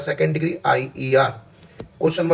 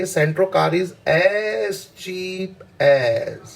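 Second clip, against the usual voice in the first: very slow, drawn-out reading of the sentence; very slow dictation; 2 semitones higher, much slower than usual. Shown as a demonstration. Teacher reading a test sentence very slowly to students. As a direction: stretch every word for the class. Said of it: "comma के बाद जोर लगाइए".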